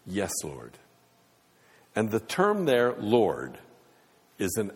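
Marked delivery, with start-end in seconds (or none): 0.1-0.8 quiet sincere answer. Acted. Blind men answer Jesus simply.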